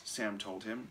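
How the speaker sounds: ordinary delivery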